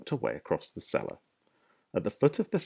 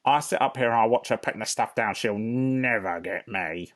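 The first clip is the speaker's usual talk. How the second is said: louder, lightly higher rodent voice; smug Rat; 3 semitones higher, 4 dB louder than usual. Acted. Rat boasting to the foxes, louder and smug. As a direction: gloat from the shelf.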